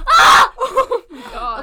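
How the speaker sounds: loud, high laughing scream; startled laughing shout. Real, not acted. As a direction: shriek then laugh in shock. Mic falls, startled laugh.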